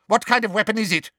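high, fast harsh question; teacher grilling a pupil harshly. Acted. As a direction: bark the question quickly. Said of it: "said Umbridge harshly".

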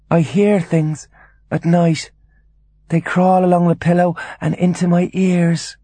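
high, eerie fearful voice; frightened invalid mother; haunted confiding. Acted. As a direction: confide night terrors in a high register. Disturbed mother confides night terrors.

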